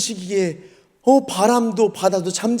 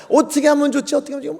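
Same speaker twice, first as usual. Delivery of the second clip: higher-pitched worried quote; mock fretting; 6 semitones higher, faster than usual. Disciples stuck crossing in the storm, fretting. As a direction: act out being at a loss.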